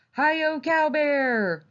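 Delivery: high, drawn-out cowboy call; long, high-pitched holler of glee; gleeful cry. A narrator's reading. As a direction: call it out like a child playing cowboy. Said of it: "cried Miranda".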